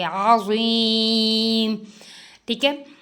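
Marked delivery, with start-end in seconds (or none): 0.0-1.8 अज़ीम with a thick, full ज़, not ज or र; heavy zwa. Shown as a demonstration. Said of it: "ज़ुईम मोटा करके". (मोटा ज़ुआ के साथ अज़ीम)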